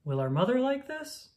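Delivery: plain reading voice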